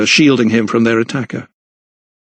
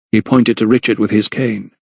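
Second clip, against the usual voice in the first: ordinary delivery, nothing marked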